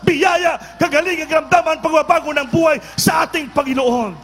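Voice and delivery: full-force shouting preacher, high and loud; preaching shout. Real, not acted. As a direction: proclaim the blessings at the top of your voice. Preacher building to climax. (sumisigaw na pangangaral)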